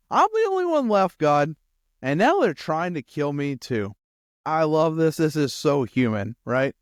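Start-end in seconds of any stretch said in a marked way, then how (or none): 0.1-3.9 high, loud aggrieved complaint; bold protest. Acted. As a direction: blurt it boldly, voice leaping up in protest. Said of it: "I bet not as boldly this time".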